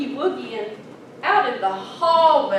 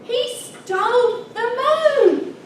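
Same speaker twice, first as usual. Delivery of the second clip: put-on kid's excited news; 8 semitones higher, 6 dB louder, slower than usual. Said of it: "I said".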